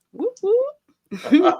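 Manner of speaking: high, loud playful whoop-whoop; cheering whoop. Acted. Host cheers the guest's twenty-year marriage, high playful whoop. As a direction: cheer him on like a hype man.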